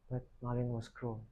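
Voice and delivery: ordinary delivery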